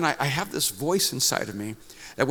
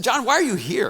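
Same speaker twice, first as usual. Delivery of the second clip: higher, louder challenge to a puzzling visitor; pointed, pitched-up questioning; insistent questioning; 10 semitones higher, 5 dB louder than usual. Retold or imitated. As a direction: press him for a real answer. Acted questioning of the atheist.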